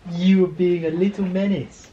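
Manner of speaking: high, loud telling-off; loud, high-pitched talk to a pet; loud pet scolding. Real, not acted. Playfully telling off a dog.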